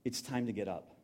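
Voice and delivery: soft, gentle whisper-close Little one; tender. Retold or imitated. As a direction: hush it down like a parent waking a child. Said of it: "say to us".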